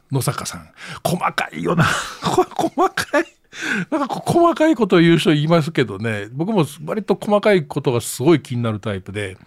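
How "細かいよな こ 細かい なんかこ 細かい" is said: hammed-up whiny complaint, breaking high; mock exasperation. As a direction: squeal it like a fussy nitpicker. Mocking the senior's nitpicking.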